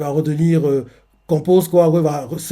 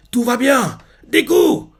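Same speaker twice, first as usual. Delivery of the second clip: cri aigu pour calmer; appel de Mirio; 9 semitones higher, 3 dB louder, slower than usual. Retold or imitated. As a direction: appeler fort un ami qui s'emballe. Mirio crie à Deku. (Mirio crie pour calmer Deku, voix aiguë)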